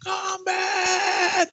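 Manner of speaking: high booming game announcer yell; announcer shout. Acted. Announcer-style shout of game title.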